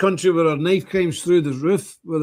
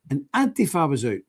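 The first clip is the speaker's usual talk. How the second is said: normal talking voice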